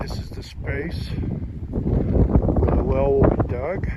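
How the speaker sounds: huffing and puffing after a climb; breathless, gasping between words; out of breath. Real, not acted. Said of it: "if I'm huffing and puffing".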